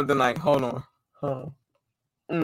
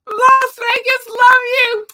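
much higher, slower squealed greeting; excited squeal. Real, not acted. Streamer shouting out Vegas fans, squealing.

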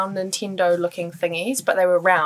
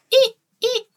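eek noises. Retold or imitated. Imitating Tamagotchi noises.

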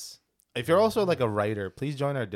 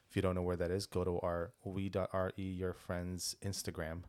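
ordinary delivery

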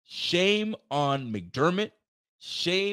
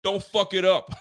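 higher, louder warning to the team; pep-talk warning; 7 semitones higher, 3 dB louder than usual. Retold or imitated. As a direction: fire up the players before they go out. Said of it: "I would have been like".

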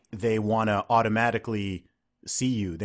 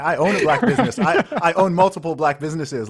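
high, loud laughing brag; laughing mock boast; 7 semitones higher, 7 dB louder than usual. Guest mocking critics, laughing, high and loud. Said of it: "I'm like".

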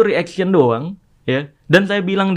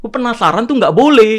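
louder, higher mock objection; raised voice, retelling a rebuke; retold scolding; 6 semitones higher, 6 dB louder than usual. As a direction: imitate someone indignantly forbidding it.

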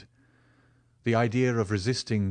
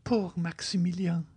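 put-on high, whispery young woman's murmur; tender murmur; 9 semitones higher than usual. A narrator's reading. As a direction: murmur it high and airy with pity. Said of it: "murmured Valentine".

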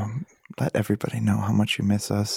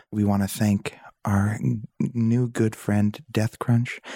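ordinary talk

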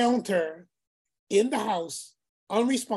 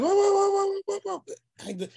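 high, startled run of whoas; shocked whoa; 12 semitones higher than usual. Retold or imitated. Mother stunned by the call, high startled whoas. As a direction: pull back in alarm at the news.

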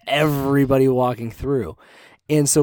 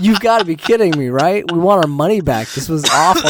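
louder, higher protest; mock outrage; 5 semitones higher, 9 dB louder than usual. Friends complaining to the exit worker, loud mock outrage. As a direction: demand the money back.

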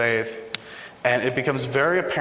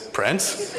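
high, laughing question of disbelief; can't believe it's really him; stunned disbelief; 7 semitones higher than usual. Retold or imitated. Stunned [name] himself is calling.

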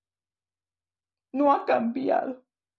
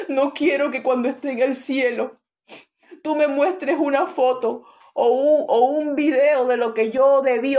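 louder pleading prayer to the Lord; modelled prayer; 3 semitones higher, 5 dB louder than usual. Shown as a demonstration. Preacher modelling a prayer for the congregation, pleading and louder. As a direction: lift the plea up to God, pressing. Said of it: "Díganle".

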